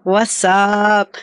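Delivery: how y'all doing drawled very slow in a Texas accent; lazy, stretched-out Texas greeting; Southern drawl. Shown as a demonstration. Texan demonstrating a friendly Southern greeting, slow drawl. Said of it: "we like to say things like".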